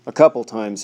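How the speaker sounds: gasping for air after a fall; breathless, gasping the words out; out of breath. A narrator's reading. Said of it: "he gasped".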